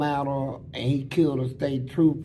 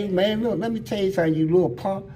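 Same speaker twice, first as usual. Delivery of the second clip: mock threat; 6 semitones higher, faster than usual. Threatening the crying cellmate.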